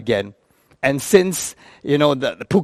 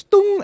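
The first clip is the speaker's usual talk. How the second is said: high "tung!" chime; ping like a cabin call button; imitated call bell; 3 dB louder than usual. Woman calling the flight attendant, high imitated bell. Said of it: "And so she goes".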